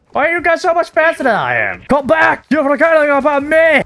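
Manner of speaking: high, plaintive shout after teammates; indignant high-pitched calling. Real, not acted. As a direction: yell after people leaving you behind.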